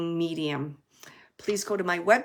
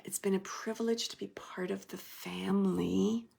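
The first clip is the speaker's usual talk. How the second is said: whisper; 7 dB quieter than usual. Retold or imitated. Relaying spirit softly.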